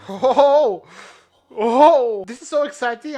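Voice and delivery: loud, high, excited exclamations; thrilled outburst. Real, not acted. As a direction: burst out with real excitement.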